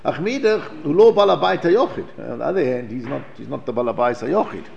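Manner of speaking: normal talking voice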